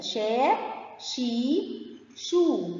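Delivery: "شا، شي، شو" each drawn out long; three long vowels. Shown as a demonstration. Teacher recapping for pupils, "شا، شي، شو" with long vowels. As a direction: hold each vowel in "شا، شي، شو", separated by pauses. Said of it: "شين ضمة مد بالواو".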